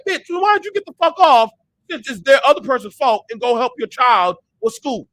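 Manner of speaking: loud, heated retort with a slowed ending; exasperated yell. Retold or imitated. Fed up with deadbeat parents, he yells back. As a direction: let them have it, fed up. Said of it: "I'm like".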